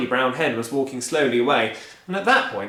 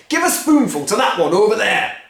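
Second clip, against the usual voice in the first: higher, loud, impatient; eager father; 9 semitones higher, 9 dB louder than usual. Acted. Mister Cranky urging George, eager and loud. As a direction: urge George on.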